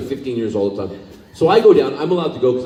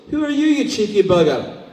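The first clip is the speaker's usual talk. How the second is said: put-on higher, cheeky Glaswegian accent; Billy Connolly Scottish; 8 semitones higher than usual. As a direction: tease him in a Glasgow accent. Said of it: "He goes".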